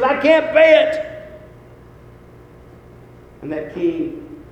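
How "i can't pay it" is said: loud, high plea for mercy; pleading debtor. Retold or imitated. Debtor at the mercy of the king, acted pleading. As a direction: cry it out as the desperate debtor. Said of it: "this guy throws himself at the, uh, mercy of the king".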